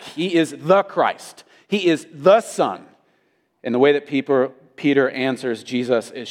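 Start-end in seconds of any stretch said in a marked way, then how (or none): 0.0-2.9 slow, heavy stress on "the"; deliberate emphasis on the article "the"; emphatic THE. Shown as a demonstration. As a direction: hit "the" hard in each sentence, drawing it out. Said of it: "So it's emphatic".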